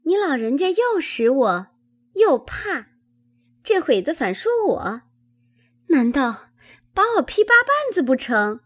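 put-on high-pitched girlish protest; protesting young maid. Chunyan arguing back to her old aunt, high indignant protest. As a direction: answer back in a high, pert maid's voice.

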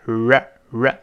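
"r" with the tongue tip curled back, slowly; slow, isolated retroflex "r". Shown as a demonstration. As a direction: hold a rounded English "r", twice. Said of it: "舌尖要勾回来".

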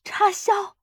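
put-on high, urgent old woman's shout; loud shout. A narrator's reading. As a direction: call out sharply for help. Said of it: "她大声喊道". (急切地高声喊)

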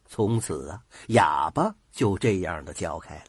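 usual speaking voice

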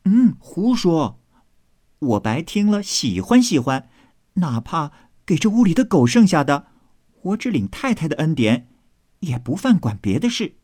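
high, lightly playful maid voice; amused retort. Acted. Maid shrugging off teasing, high and amused. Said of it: "秋文笑道".

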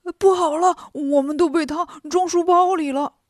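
loud, high alarmed yelling; clamouring. A narrator's reading. Pigsy yells to his companions, loud and alarmed. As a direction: cry out in a panic that they're trapped. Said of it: "八戒嚷嚷着说".

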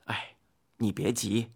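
gentle soothing. A narrator's reading. Hou Yi asks his wife to sit down, gently soothing. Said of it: "后羿柔声说道".

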